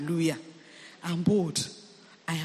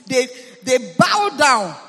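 bow down, loud and heavy; preacher's loud emphasis; emphatic; 3 semitones higher, 8 dB louder than usual. Acted. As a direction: hammer the phrase home. Preacher stresses demons bowing.

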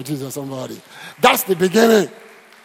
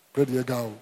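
normal talking voice

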